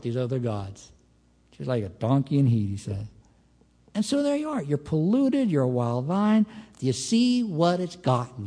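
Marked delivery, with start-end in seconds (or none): none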